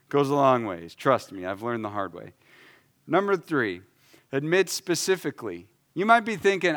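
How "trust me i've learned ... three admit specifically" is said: ordinary delivery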